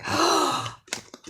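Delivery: sharp breathy gasp of delight; excited gasp. Retold or imitated. Reader recalling her reaction to viewers, sharp gasp. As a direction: gasp as if struck by how great they are. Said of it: "I was like".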